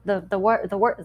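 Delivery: tongue-tied, restarting "the wor-"; tripping over the same word twice; stumbling over words. Real, not acted. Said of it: "bleh, I cannot talk tonight".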